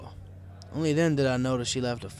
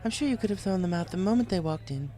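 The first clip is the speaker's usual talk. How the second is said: higher, playful lady pony; Rarity voice; 6 semitones higher than usual. A narrator's reading. Rarity plays along with the disguised kid, teasing. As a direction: play along sweetly.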